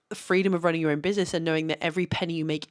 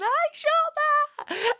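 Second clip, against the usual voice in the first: very high and drawn out, slow; awed squeal; 3 dB louder, much slower than usual. Retold or imitated. Proudly telling friends about her advert, a slow high squeal. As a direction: stretch it out in giddy disbelief.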